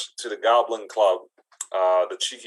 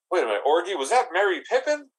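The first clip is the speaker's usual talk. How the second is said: shocked goblin; 6 semitones higher, faster than usual. Acted. Goblin shocked to see the hobbit, high disbelief.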